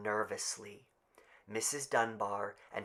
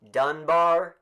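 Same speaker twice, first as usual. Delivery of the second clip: higher, louder call; raised roll call; calling out a name; 7 semitones higher, 11 dB louder than usual. Acted.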